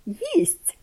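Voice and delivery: put-on high excited cry of a boy; excited shout. A narrator's reading. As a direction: call out in delight. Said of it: "гукнув Петрик". (радісно гукає)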